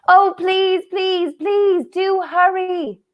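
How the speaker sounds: put-on high, slow whiny children's plea; children begging. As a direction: drag out the begging like impatient kids. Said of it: "said the three children".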